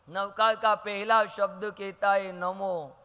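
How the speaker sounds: ordinary talk, nothing marked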